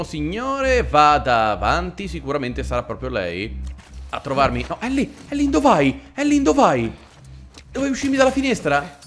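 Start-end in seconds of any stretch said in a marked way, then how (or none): 4.7-9.0 high, alarmed calls after Ellie; alarmed calling out. Real, not acted. Gamer yelling at companion running off, alarmed. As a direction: call her back in a panic. Companion runs off mid-fight.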